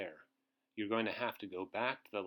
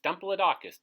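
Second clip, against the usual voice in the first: playful repeat; 6 semitones higher, 9 dB louder, faster than usual. Shown as a demonstration. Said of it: "His name is fun to say".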